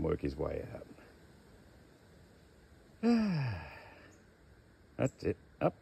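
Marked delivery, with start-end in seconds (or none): 3.0-3.7 high, falling sigh; long sigh. Real, not acted. Handler waiting on a stubborn snake, long sigh. Snake will not come out.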